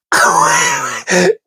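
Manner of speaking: high squealing burst of laughter; shrieking laugh. Real, not acted. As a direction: crack up helplessly.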